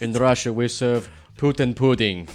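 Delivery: slow hammed-up Russian accent; mock Russian villain, slow and proud; bad Russian accent. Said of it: "That's as close as you're gonna get".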